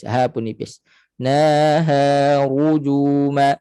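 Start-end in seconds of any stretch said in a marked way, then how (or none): 1.1-3.5 NAHA RUJUMA slowly chanted with heavy RU; thin NAHA, then thick RU in rujuma. Shown as a demonstration. Teacher models recitation for students, thick ru. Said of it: "ru kena tebal".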